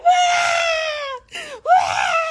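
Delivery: loud high mock scream; imitated scream. Brother pulled to her chest, loud mock shriek. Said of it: "and he was like".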